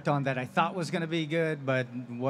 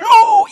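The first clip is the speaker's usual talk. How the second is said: very high, very loud goblin shriek; goblin scream; 21 dB louder than usual. Retold or imitated. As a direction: shriek as if landing on a spike.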